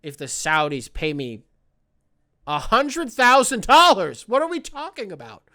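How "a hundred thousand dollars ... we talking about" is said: high, loud excited outburst; breathless excited yell; excited shout. Real, not acted. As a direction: yell the sum in disbelief. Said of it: "I ran out of oxygen at the end of that".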